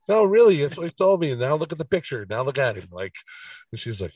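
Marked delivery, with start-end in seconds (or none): 0.1-2.8 excited insisting. Retold or imitated. Retold insisting to owner.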